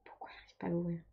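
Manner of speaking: a frustrated whisper under breath; whispered frustration. Real, not acted. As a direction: whisper while forcing the lid. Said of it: "je galère trop".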